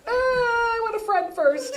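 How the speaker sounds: high, slow whiny cry; sulking child; mock whine. Whiny kid reply.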